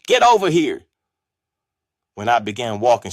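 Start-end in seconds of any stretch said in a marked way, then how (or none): 0.1-0.9 high, loud command; grandmother's yell. A narrator's reading. Grandmother summoning the boy in trouble, yelled. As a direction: yell the order, much higher and louder. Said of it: "My grandmother yelled".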